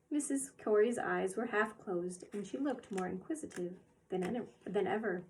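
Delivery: ordinary delivery, nothing marked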